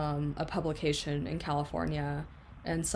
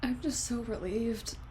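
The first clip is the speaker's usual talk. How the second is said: higher, teary voice of relief; real crying with relief, pitched up; tearful relief; 7 semitones higher than usual. Real, not acted. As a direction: speak through happy tears. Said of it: "first time that I've cried on camera".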